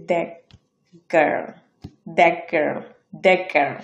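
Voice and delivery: put-on "the girl" as dak-girl, T unreleased; glottal stop. Shown as a demonstration. Teacher models glottal stop, "the girl" as dak-girl. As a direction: catch "the girl" in the throat after the first word. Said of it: "ঠ তো উচ্চারিত হচ্ছেই না".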